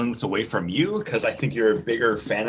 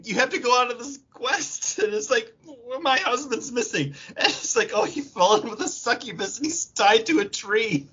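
laughing; 9 semitones higher than usual. Acted. Voicing the quest giver, laughing.

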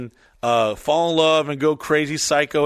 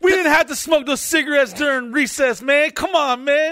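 much higher, louder melodramatic plea; hammed-up pleading cop; 13 semitones higher, 4 dB louder than usual. Comic voicing of the cop friend pulling him over, high melodramatic plea. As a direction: overplay a tortured cop friend.

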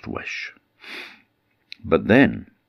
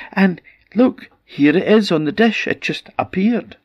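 much higher wife's voice, bright with delight; excited wife's voice; 3 dB louder than usual. Acted. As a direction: show off the sausage in a high pleased voice. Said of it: "she said".

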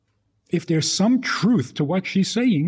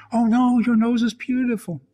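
gushing fake reassurance; 9 semitones higher than usual. Parent's false reassurance, then criticized.

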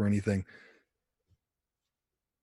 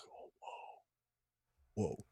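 much softer, breathless whoas of awe; hushed whoa; 5 semitones lower, 21 dB quieter than usual. Retold or imitated. Struck by the Spirit at Mass, hushed awe. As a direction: let it fall away in quiet wonder.

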